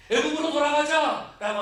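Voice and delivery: high, loud mock whine of the complainers; grumbling crowd. Pastor mimics the Israelites demanding to return, high mock outcry. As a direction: mimic the Israelites crying to go back.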